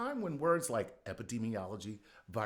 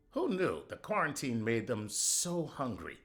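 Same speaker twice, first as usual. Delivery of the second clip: usual speaking voice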